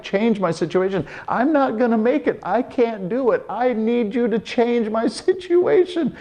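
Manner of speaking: high, pleading cry of a man at the end of his rope; desperate pleading. Retold or imitated. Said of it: "Paul had been crying out for a change".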